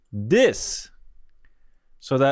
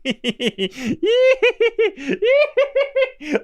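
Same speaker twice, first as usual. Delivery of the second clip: laughing disbelief; 8 semitones higher, much slower than usual. Real, not acted. Laughing at the transformation.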